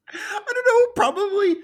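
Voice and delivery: high, loud, cracking-up voice; laughing helplessly. Real, not acted. Podcast host reacting to absurd news with the co-host, laughing. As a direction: squeal it out through real laughter.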